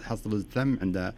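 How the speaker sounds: usual speaking voice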